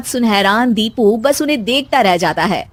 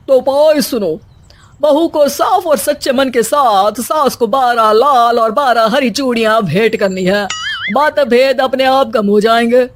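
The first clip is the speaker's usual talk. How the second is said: pompous baba. Acted. Baba prescribes a remedy.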